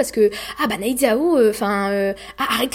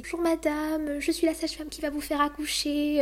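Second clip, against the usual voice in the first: higher and softer, a gentle midwife voice; 5 semitones higher, 8 dB quieter than usual. Retold or imitated. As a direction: greet her tenderly, like a kind midwife. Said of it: "une voix, mais douce".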